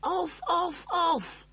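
high, loud, rapid-fire screams; screamed repeated command. A narrator's reading. Frantic computer trying to switch off, screaming. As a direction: scream it over and over. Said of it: "he screamed".

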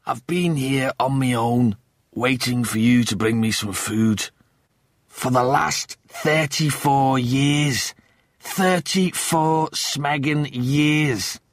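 slow, building indignation; bitter complaint. Acted. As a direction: draw out the years to make the grievance land. Lister's bitter complaint after decades stranded.

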